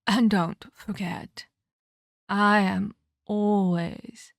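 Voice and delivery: slow ominous. Acted. Ominous farewell.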